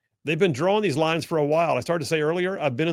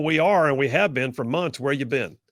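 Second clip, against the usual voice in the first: ordinary talk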